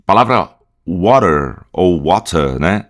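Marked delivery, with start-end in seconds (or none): 0.9-1.6 "water" with American flapped T and final R; American English accent. Shown as a demonstration. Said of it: "em inglês americano". 2.0-2.6 "water" British, crisp T and no final R; British English accent. Shown as a demonstration. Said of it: "Em inglês britânico".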